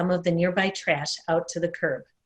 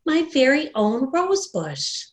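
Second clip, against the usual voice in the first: slow, higher, savoring each word; dreamy wonder; 6 semitones higher than usual. Acted. Wanda whispering to herself about her bush: slow, higher wonder. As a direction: hold it like a treasured secret.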